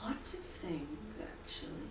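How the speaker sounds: slow, whispery dark figure; soft, drawn-out raspy whisper. Acted. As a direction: whisper it slowly with a dark rasp. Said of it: "a dark, raspy voice".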